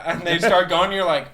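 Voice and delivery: ordinary talk, nothing marked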